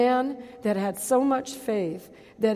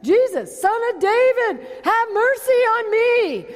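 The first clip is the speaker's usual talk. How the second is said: crying out again; 9 semitones higher, 3 dB louder than usual. Retold or imitated. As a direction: stretch the cry out to be heard. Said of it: "He said it again".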